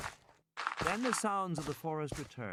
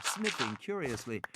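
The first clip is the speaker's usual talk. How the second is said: ordinary delivery, nothing marked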